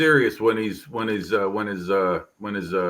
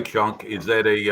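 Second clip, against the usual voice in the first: ordinary talk, nothing marked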